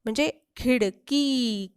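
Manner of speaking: खिडकी with की drawn out, slowly; long ee. Shown as a demonstration. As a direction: stretch the की in खिडकी. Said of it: "कीचा उच्चार बघा लांबवलाय". (की लांबवून)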